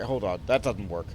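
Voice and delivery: ordinary delivery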